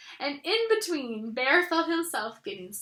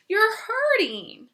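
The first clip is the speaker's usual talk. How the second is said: pained complaint; 8 semitones higher, 5 dB louder, slower than usual. Acted. Pooh pulled, in pain.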